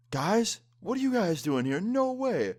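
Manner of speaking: high, loud imitation of a drunk, thrilled teacher; hammed-up delighted squeal, imitating the teacher; freaking out happily. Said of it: "freaking out 'cause he's happy to see us".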